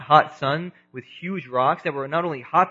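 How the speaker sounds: ordinary talk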